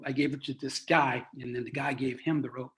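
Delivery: ordinary delivery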